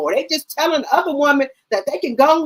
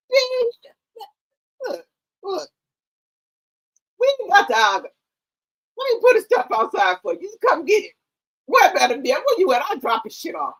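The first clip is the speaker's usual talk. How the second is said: mock sweet brush-off; 5 semitones higher than usual. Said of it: "mock reply to rival woman".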